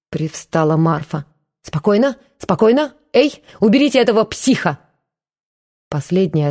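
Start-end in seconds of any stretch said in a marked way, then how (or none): 1.6-4.8 panicky. A narrator's reading. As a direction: back away shouting for help. Antsiferov panics at Matvey.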